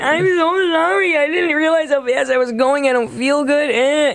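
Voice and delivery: high, wailing imitation of tearful pleading; mock crying. Pulled-over driver pleading with police, mock crying. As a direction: whine it like a hammed-up sob, easing slightly. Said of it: "And I started crying".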